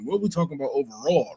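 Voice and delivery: usual speaking voice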